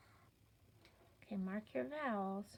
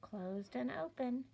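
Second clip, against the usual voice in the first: ordinary talk, nothing marked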